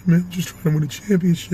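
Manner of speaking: winded athlete after a game, words dropping out; Paul Pierce. Acted. Player interviewed after game, out-of-breath Paul Pierce voice. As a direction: voice going in and out. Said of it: "His voice always be going in and out".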